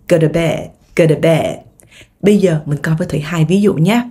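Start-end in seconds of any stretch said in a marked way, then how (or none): 0.1-1.6 said fast. Shown as a demonstration. Teacher drills fast speech for learners, run-together GO TO BED. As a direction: GO TO BED squeezed into guh-duh-bed. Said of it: "Nói nhanh".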